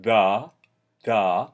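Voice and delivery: da with the tongue tip; plain hard da, tongue tip up. Shown as a demonstration. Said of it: "the tip of the tongue".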